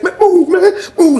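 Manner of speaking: high-pitched retold plea; acted urgent demand. Sean demands passage.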